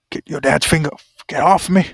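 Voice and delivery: loud acted protest; acted struggle. Character fighting off an attacker, loud acted protest. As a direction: push the words out forcefully, mid-struggle.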